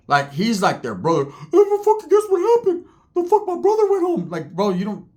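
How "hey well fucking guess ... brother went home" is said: very high, squeaky put-on voice; cartoonish high mock voice; Ricky's voice. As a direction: squeak it out like a whiny cartoon con. Voicing Ricky on the phone.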